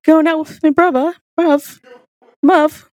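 hammed-up Cockney through giggles; badly faked Cockney impression; Idris Elba. Doing Idris on request, mock Cockney. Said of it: "Do your best Idris impression doing Cockney".

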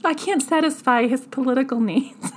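giggling while quoting; can't keep a straight face; laughing paraphrase; laughing through a quote. Acted. Paraphrasing Josephine, cracking up.

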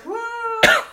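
high, loud dazed whoa; high-as-a-kite whoa. Retold or imitated. Drugged on laughing gas, dazed and amazed. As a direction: float the word out stoned. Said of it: "I was just like".

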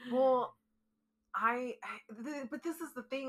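loud, high, heated; upset. Real, not acted. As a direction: burst out annoyed. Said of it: "I'm getting so upset".

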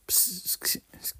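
breathy exasperated whisper. Real, not acted. Ranting at a friend who dislikes Coca-Cola, whispered. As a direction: drop to a whisper in disbelief.

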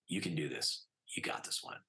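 hushed, trailing off with real emotion; softly, getting choked up as you retell it; quiet, low, voice giving way. Real, not acted. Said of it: "and I still get choked up".

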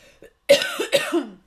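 harsh coughing fit from a swallowed chip; choking cough. Real, not acted. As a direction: cough hard while choking.

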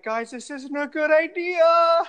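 high, loud, drawn-out mock wail; panicked protest. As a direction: whine it in exaggerated panic, stretching the words. Retells his panicked objection.

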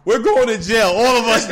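loud retold protest; acted exasperated retort. Storyteller replaying his answer to the stripper, loud exasperation. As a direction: raise the volume as if arguing back.

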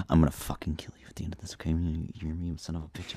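hushed mock threat; whispered threat. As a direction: hiss a joking death threat under the breath. Mock threat after a prank.

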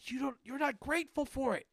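high, loud, hammed-up outrage; mock dad tirade building to a yell; angry rant. Father scolds ungrateful kids over piles of toys, angry. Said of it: "it kinda made me angry".

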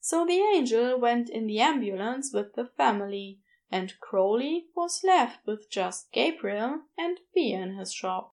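ordinary delivery

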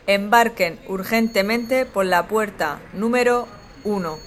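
calm, even last-call PA read; airport announcement. Acted. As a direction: read it like a terminal boarding call. Airport final boarding call.

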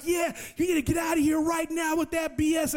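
high, shouted threat; threatening shout. Retold or imitated. Jesus advances on Marco and yells, threatening shout. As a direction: run him out with contempt. Jesus walks toward him yelling.

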